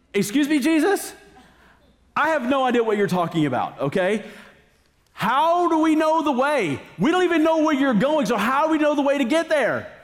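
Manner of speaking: high, incredulous mock confusion; baffled Thomas. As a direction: play Thomas as utterly lost and exasperated. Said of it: "And Thomas is like".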